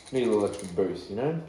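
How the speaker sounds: ordinary talk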